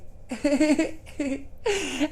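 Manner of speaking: high giggle after a boast; gleeful laugh. Acted. Dragon-blooded speaker amused by own boast, gleeful giggle.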